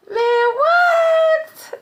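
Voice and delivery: high, stretched disbelief on what; stunned, squeaky what; incredulous what. Real, not acted. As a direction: pitch it way up in disbelief. Reacting to absurd government claim.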